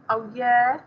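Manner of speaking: mildly sneering challenge with a rise; a touch of mocking disbelief, questioning. A narrator's reading. Voice in his head challenging him, mildly sneering. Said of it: "sneered the voice in my head".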